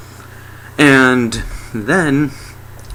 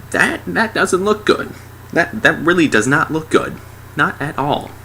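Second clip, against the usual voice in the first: higher-pitched put-on voice of doubt; dubious onlooker; 6 semitones higher than usual. Guy looking at the blue lines, high dubious voice. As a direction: voice a worried guy in a raised pitch.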